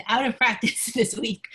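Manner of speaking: chuckling through the words; light self-deprecating laugh while talking. Real, not acted. Host admitting to guests she is rusty, laughing.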